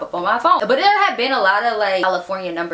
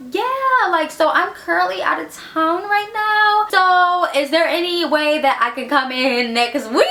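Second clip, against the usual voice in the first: sweet phone voice; 10 semitones higher, slower than usual. Retold or imitated. Overly polite phone voice acted.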